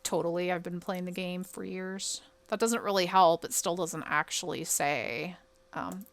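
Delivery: ordinary talk, nothing marked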